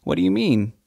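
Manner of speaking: ordinary talk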